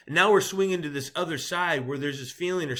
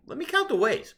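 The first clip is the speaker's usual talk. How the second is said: mock wry aside; 11 semitones higher, 3 dB louder than usual. Joking aside after question.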